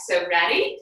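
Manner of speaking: normal talking voice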